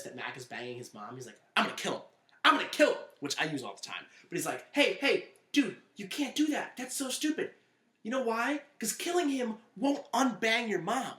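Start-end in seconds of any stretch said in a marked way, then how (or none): 1.6-3.0 high, building to a loud yell; mock enraged threat. Acted threat of Charlie from show. 4.7-11.1 high-pitched imitation of arguing; mock urgent dissuading. Acted reasoning with Charlie in quote.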